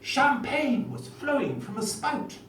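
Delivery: put-on a touch of a plummy Etonian schoolboy accent; posh Etonian accent. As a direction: recite the comic verse in a hammed-up Etonian accent. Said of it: "in my best Etonian accent".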